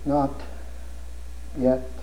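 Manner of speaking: soft mumble; mumbled. Retold or imitated. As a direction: mumble it under your breath. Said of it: "mumble down through the sacrament".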